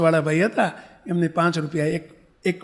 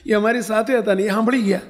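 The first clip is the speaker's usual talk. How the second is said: ordinary talk, nothing marked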